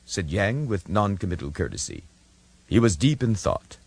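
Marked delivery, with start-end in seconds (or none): none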